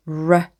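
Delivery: R as a curled-tongue 'ruh' sound. Shown as a demonstration. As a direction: curl the tongue for R, not its letter name. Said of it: "혀 굴리는". (R을 혀 굴려 발음)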